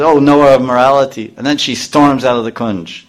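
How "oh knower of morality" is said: scornful. Retold or imitated. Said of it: "She says".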